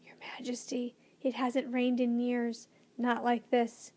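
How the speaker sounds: ordinary delivery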